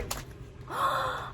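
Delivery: high, soft intake of breath; excited gasp. Real, not acted. Vlogger seeing a new figure, excited gasp. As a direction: gasp in delighted surprise.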